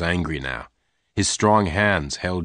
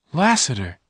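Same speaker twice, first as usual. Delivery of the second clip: higher call of his name; cry out; 7 semitones higher than usual. Acted. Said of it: "Jane cried".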